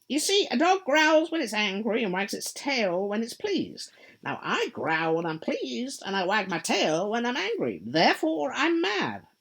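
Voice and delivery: high, loud, sly grinning cat voice; a teasing cat, pleased with itself; Cheshire Cat. Acted. The cat answering lost Alice, high and sly.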